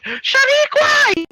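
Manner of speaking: very high, stretched-out cry; hammed-up high-pitched call. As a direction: yell the name in mock desperation. Character calls out a name.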